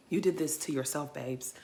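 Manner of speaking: quiet, resigned You did this to yourself; quiet resigned aside. Real, not acted. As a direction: let it fall soft. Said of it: "repeated phrase".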